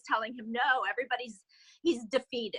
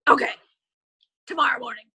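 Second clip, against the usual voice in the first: Larry King grumble; 8 dB louder, faster than usual. Retold or imitated. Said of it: "you know how Larry King can be".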